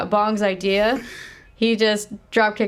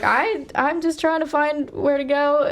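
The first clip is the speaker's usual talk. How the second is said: mock flustered stranger; 8 semitones higher than usual. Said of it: "who is like".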